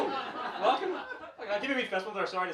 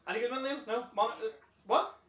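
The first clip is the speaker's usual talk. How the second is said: normal talking voice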